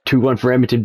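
ordinary talk

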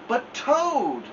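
high pleading call; crying out. A narrator's reading. Frog begging Toad to stay up, crying out. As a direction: plead with him not to go. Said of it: "cried Frog".

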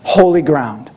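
low, solemn divine voice; voice Moses hears. Retold or imitated. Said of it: "The first thing he hears".

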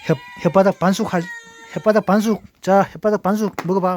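high, loud teasing taunt. Real, not acted. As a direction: taunt the cats loudly. (고양이들 놀리며 크게)

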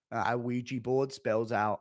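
usual speaking voice

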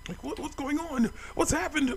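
very high put-on panicky voice; mock alarm. Character finding home on fire, mock alarm. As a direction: squeal it in exaggerated bewilderment.

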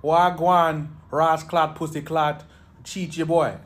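lightly put-on Jamaican accent; mock Jamaican patois. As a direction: play a Jamaican mate hollering hello. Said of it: "And Damien's like".

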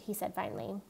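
ordinary delivery

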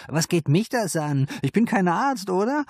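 high, defiant madam voice; madam brushing off the police; defensive madam. A narrator's reading. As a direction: shrug off the detective's question. Madam deflects police questions.

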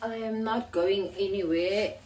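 normal talking voice

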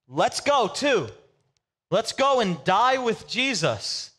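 a hyped disciple urging them all on. Retold or imitated. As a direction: announce it like a bold rallying cry. Thomas retold again, hyped.

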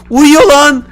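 high, loud yell of discovery; excited shout. Real, not acted. Taunting shout at sleeping enemy.

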